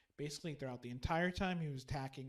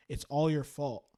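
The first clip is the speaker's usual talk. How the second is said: retold accusation; 10 dB louder than usual. Voice in priest's last dream.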